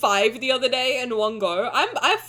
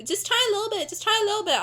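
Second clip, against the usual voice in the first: higher, softer coaxing voice; pushy friend; 7 semitones higher, 4 dB quieter, faster than usual. Retold or imitated. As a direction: wheedle like a pushy host. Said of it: "whenever someone's like".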